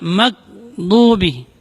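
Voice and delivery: "maghdubi" slowly as "mak-dubi", gh stopped; "maghdubi" with a hard stop for gh; held-letter mistake. Shown as a demonstration. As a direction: block "maghdubi" after "mag". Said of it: "Tidak juga tertahan di huruf".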